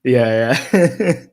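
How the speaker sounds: real laughter breaking through high, loud words; helpless giggling while agreeing; laughing at self. Real, not acted. Caught laughing at their own idea, owning up through laughter. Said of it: "Are you laughing at yourself?".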